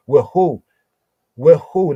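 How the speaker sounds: "With who?" punched hard and loud, rising at the end; emphatic. Shown as a demonstration. Teacher stressing the Hebrew question to his class, emphatic. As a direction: hit "With who?" as the key point. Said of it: "Now, this is emphatic here".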